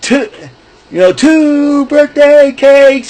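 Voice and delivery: the clumsy baker calling out his cakes, high and slow; baker's call. Retold or imitated. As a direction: announce the cakes like the Muppet baker. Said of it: "and they'll say".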